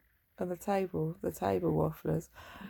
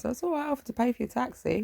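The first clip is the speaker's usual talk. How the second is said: cheeky son; 7 semitones higher, faster than usual. Retold or imitated. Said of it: "his normal cheeky way".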